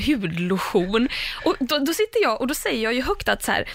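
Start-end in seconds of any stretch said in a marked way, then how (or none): 0.0-1.1 slow "hudlotion" ending in -SCHON; Swedish-style "lotion". Retold or imitated. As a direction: imitate hudlotion said as hud-lo-SCHON. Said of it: "ni uttalar det lotion". (härmat, försvenskat "hudlotion")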